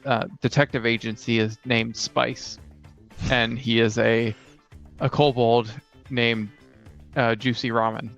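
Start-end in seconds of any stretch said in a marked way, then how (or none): none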